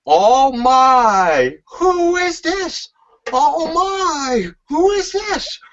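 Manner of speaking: mock-amazed commentator, drawn out and high; Michael Cole impression. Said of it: "you hear Michael Cole say".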